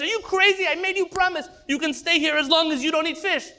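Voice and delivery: bursting through the door, outraged; high indignant outburst; outraged priest. Acted.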